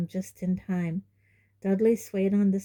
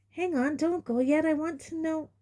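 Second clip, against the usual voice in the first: put-on higher, urgent boy; don't go yet, urgent; boy begging neighbour to stay; Harry pleading; 9 semitones higher than usual. A narrator's reading. Voices Harry.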